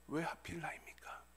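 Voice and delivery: very soft, drained plea; hushed anguish. Acted. Bereaved father asking God why, hushed pained plea. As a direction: let the question sink almost to a whisper. Same plea, sunk to hush.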